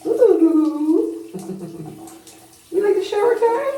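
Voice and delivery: chirpy pet-talk question. Real, not acted. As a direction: coo up high at the little pirate. Cooing to pet.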